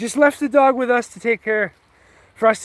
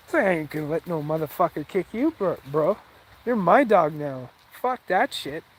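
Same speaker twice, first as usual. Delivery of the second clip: lower, softer aside to the dog; talking to the dog; 7 semitones lower, 6 dB quieter than usual. Real, not acted. Owner turning to his dog, low and soft reassurance. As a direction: reassure your dog he's safe now.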